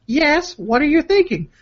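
high-pitched put-on girlfriend voice; hammed-up girly texting voice. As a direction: read it in a mock-girlish falsetto. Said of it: "a girly voice for this part".

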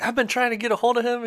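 high, rushed, excited voice. Retold or imitated. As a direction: imitate a man gushing with excitement. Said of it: "he was so excited".

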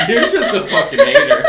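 high, loud laughing tease. Real, not acted. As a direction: laugh it at your friend. Teasing friend, laughing.

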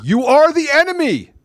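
high, loud, drawn-out accusation; angry rant. Real, not acted. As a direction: raise the pitch sharply and denounce them. Political rant at opponents.

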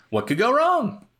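high mock-innocent question; sarcastic. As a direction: ask it with heavy irony. Sarcastic aside.

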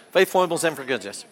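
rapid-fire tongue twister; real fast. Shown as a demonstration. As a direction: rattle the three words off quickly. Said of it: "Now let's say it real fast".

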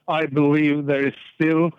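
ordinary delivery

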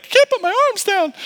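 put-on very high, stiff child voice; whiny kid. Christmas Story kid in a snowsuit, squeaky high whine. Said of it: "he's like".

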